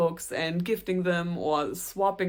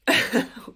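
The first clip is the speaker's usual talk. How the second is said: embarrassed laugh; 5 dB louder than usual. Real, not acted. Breaks off mid-sentence laughing.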